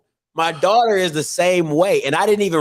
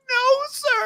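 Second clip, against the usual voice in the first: much higher whiny crying voice; imitating crying daughter. Imitating daughter denying while crying, high whine. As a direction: whimper like a caught kid.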